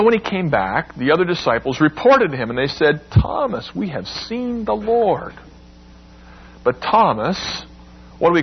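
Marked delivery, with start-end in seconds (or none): 3.1-5.3 excited news. Acted. Disciples excitedly tell Thomas.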